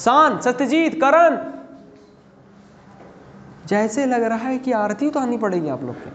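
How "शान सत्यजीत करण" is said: loud, high roll-call of students; calling out names. Real, not acted. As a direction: call each student out, slowly and loudly. (छात्रों को ज़ोर से पुकारना)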